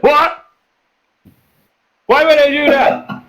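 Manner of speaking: high-pitched indignant yell in character. Acted. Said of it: "Oh, stop yelling".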